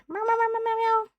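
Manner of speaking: high soft playful meowing like a kitten; cute cat meows. Pretend. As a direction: meow at chat like a teasing cat.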